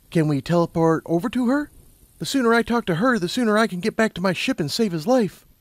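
high-pitched, eager urgency; Lumina, urgent. A narrator's reading. Lumina hurrying to save his life, urgent. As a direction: push to get moving, voice pitched high.

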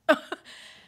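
quick real laugh; a loud laugh at the memory; short burst of laughter. Real, not acted.

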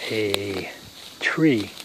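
usual speaking voice

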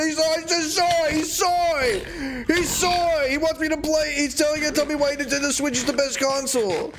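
put-on whiny kid voice.

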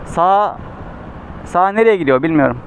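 ordinary talk, nothing marked

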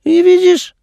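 high, bright-eyed mother voice; mother's voice. A narrator's reading. Mother's voice quoted in story.

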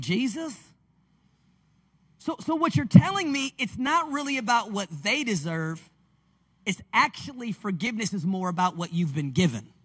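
usual speaking voice

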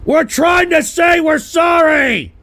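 high-pitched, stumbling plea rising to a shout; urgent shouted apology, stretched out at the end; desperate pleading. Real, not acted. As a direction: beg frantically, voice pushed way up. Begs her to stay, apologising.

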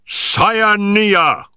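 high, drawn-out cry of triumph; triumphant shout. A narrator's reading. Searcher cries out on spotting the creature, triumphant shout. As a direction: shout it out high, holding the word. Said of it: "burst into a shout of triumph".

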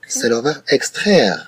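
ordinary talk, nothing marked

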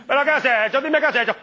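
put-on high, excitable Italian-accented outburst; hammed-up Italian accent; mock Italian accent. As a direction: plead loudly like an Italian film actor. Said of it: "hablan más o menos así".

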